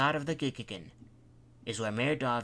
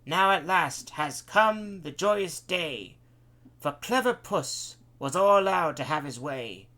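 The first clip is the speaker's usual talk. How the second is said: high, loud, slow stage recital; theatrical flourish; 5 semitones higher, 5 dB louder than usual. A narrator's reading. Puss addressing the audience with a grand flourish. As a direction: declaim it like a pantomime cat taking a bow. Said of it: "spoke with a flourish".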